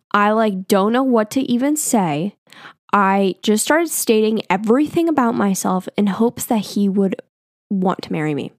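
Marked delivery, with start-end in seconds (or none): none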